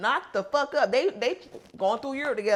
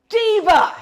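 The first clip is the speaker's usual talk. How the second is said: high, loud comic shout; one big admiring holler; loud exclamation; 10 semitones higher, 13 dB louder than usual. Acted. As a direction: belt it out in mock awe.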